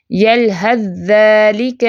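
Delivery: very slow drawn recitation, ث merged into ذ; tajweed recitation. Shown as a demonstration. Teacher demonstrates idgham of tha into dhal, very slow. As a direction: recite یلحظ ذالک slowly with ث absorbed. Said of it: "ہم یلحظ ذالک نہیں پڑھیں گے".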